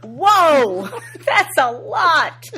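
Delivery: loud, high, slow amazed whoa. Retold or imitated. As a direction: gape at the number. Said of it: "Candy is like".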